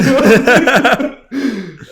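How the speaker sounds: big high burst of laughter; loud laugh. Retold or imitated. Friends baffled at conference.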